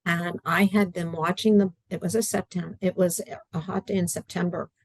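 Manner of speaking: usual speaking voice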